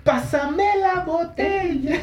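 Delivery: put-on slow, high, drawn-out drunk voice; drunk demand. Friend mimicking the drunk guy grabbing the bottle, slow high voice. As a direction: stretch it out like a wasted guy.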